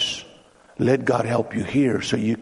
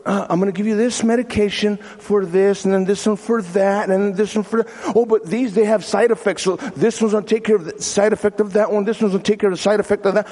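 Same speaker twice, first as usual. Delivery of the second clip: higher, rushed rattling-off of medications; psychiatrist prescribing; 6 semitones higher, faster than usual. Acted. Lady psychiatrist prescribes pills and side-effect fixes, rattled off. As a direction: reel off pill after pill. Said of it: "the lady starts asking him questions".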